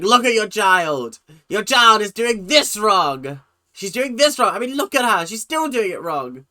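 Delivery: loud, high mock telling-off; mimicked scolding. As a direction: imitate a teacher publicly berating a child. Mimics teacher berating a child.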